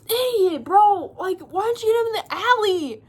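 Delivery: high, loud furious yell at a guard; scolding scream at a blunder; angry screaming. Retold or imitated. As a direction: chew him out. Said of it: "Drusilla was, like, screaming".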